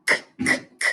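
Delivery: voiceless "k" clicks, one by one; K letter sound. Shown as a demonstration. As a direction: kick out each "k" with no vowel. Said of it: "like you're kicking a ball".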